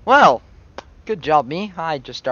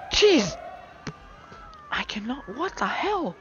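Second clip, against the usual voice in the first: exasperated; 9 semitones higher, 4 dB quieter than usual. Real, not acted. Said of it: "I'm actually gonna scream".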